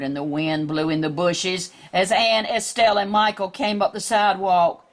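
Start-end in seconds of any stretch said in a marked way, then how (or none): none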